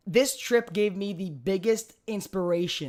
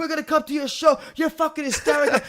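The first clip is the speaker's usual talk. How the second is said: high, hollered mock enthusiasm; imitated excited fans; 7 semitones higher than usual. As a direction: gush like a hyped-up frat bro.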